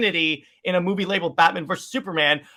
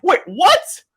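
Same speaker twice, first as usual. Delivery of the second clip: high, loud double take; acted surprise; 10 semitones higher, 6 dB louder than usual. Everyone reacting to Miss Prince, stunned surprise. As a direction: blurt it out, pitch leaping up.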